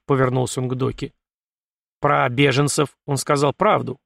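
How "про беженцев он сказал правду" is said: ordinary delivery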